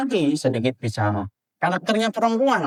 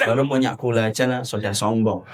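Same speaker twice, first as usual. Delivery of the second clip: low, half-laughing grumble; mock a jealous grumble under the breath; grumbling guest; 9 semitones lower than usual. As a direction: Sundanese guest grumbling about the sweet tea, low and amused. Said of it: "Orang Sundanya pun grundel".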